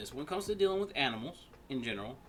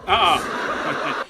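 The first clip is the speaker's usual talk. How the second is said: loud burst of laughter; laughing out loud; 4 semitones higher, 14 dB louder than usual. Real, not acted.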